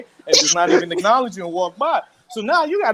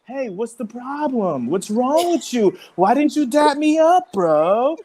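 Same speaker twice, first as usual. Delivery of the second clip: slow, raised voice of a man confronting someone; Tory; 4 semitones higher, slower than usual. Retold or imitated. Tory asks August about the ignored dap, imitated slow challenge. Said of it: "probably Tory starts asking".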